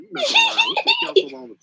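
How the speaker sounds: high, loud laughing outburst; laughing shout. Real, not acted. Frustrated caller laughing loudly.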